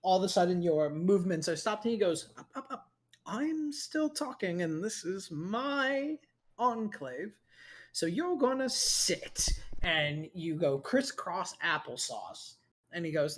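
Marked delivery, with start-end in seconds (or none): 2.4-9.5 higher, slow, smug command; a teasing captor pinning the hero in place; smug villain. Acted. Villain halting Arthur's punch, smug and slow.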